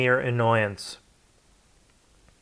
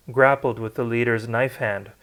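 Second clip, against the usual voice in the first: plain reading voice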